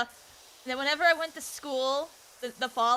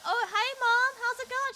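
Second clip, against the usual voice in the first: high-pitched, over-casual 'hi Mom'; acted breezy hello, pitched way up; retold casual greeting; 11 semitones higher than usual. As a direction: greet mom much higher than usual. Nervous small talk to mom.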